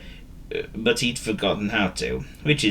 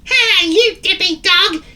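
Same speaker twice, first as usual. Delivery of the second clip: very high, loud, slow squeaky bird; mock gleefully in a piercing voice; shrill cartoon bird taunt; snickering bird; 7 dB louder, slower than usual. A narrator's reading. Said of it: "she snickered".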